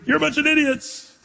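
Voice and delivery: high mock yell of contempt; mock scream. Preacher mocking angry Christians, high mock yell. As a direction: sneer it out, hammed-up insult. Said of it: "or do you scream".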